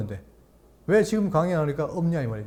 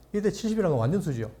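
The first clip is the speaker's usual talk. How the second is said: ordinary talk, nothing marked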